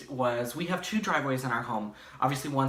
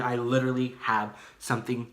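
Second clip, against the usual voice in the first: slow, drawn-out emphasis; talking slow; slower than usual. Real, not acted. Vlogger building up a crazy story for viewers, slow. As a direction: stretch the words out slowly. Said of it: "I don't know why I'm talking so slow".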